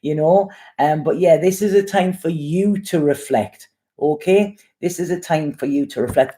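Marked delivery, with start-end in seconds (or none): none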